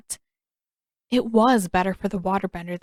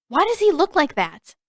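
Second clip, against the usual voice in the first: put-on higher, louder startled girl; bewildered girl; 8 semitones higher, 5 dB louder than usual. A narrator's reading. Waterbender bewildered by the news, higher and louder. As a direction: snap out a confused question.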